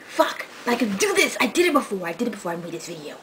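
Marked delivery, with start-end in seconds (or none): none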